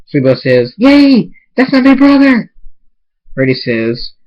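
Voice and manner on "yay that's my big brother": high, loud squeal of glee; squealing little sibling at a wedding; excited cheer. Acted. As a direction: cheer proudly, pitched way up.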